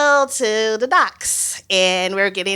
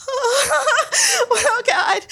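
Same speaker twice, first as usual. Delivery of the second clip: high, laughing whimper; mock dread. Hearing the water is choppy, mock dread. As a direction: wail it in comic panic, way up high.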